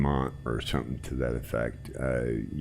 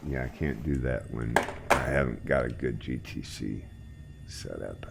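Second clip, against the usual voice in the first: ordinary delivery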